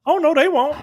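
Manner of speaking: high-pitched mock woman; woman character's voice. Woman reassures him about the cops, high-pitched mock voice. Retelling the woman's reply in her voice.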